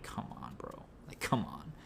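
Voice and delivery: whispered, tense muttering under the breath; hushed plea while waiting in dread; anxious whisper. Retold or imitated. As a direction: breathe it out nervously, almost silent. Waiting anxiously for their turn.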